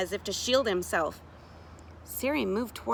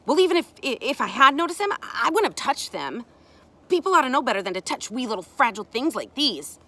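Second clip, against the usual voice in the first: louder, slightly higher protest; self-righteous child; 4 semitones higher, 6 dB louder than usual. Acted. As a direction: insist on innocence, stumbling at first.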